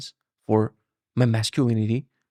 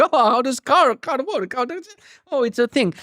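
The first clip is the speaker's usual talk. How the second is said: high, squeaky clueless voice; clueless self; 11 semitones higher than usual. Retold or imitated. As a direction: play dumb like you've never seen a car. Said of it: "And I'm here, like, learning".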